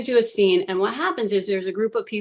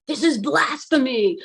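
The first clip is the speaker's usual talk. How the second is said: higher, slow mock outrage; protesting inner mind; 5 semitones higher than usual. Her conscious mind objecting to the vision, retold protest. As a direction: protest it with drawn-out, raised disbelief. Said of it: "my conscious mind is like".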